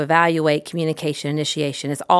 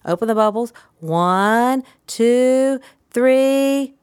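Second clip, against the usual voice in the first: high, drawn-out sing-song; Mister Rogers; 7 semitones higher, much slower than usual. Shown as a demonstration. Therapist showing how to hook a child with bubbles, sing-songy. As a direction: stretch the words melodically, building suspense on the count. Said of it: "See my Mister Rogers".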